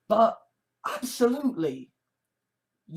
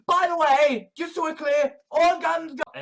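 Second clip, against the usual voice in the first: high, loud clarification; raised-voice, emphatic rant; insistent shout; 6 semitones higher, 5 dB louder than usual. Real, not acted. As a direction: call it out so nobody can miss it. Insistent shouted anti-gun rant.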